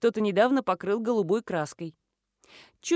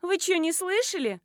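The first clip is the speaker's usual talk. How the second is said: put-on high, cheeky girlish question; teenage girl; 8 semitones higher than usual. A narrator's reading. Schoolgirl challenges the woman's hearing, cheeky girlish voice. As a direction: needle the adult for not listening. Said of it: "повторила девочка".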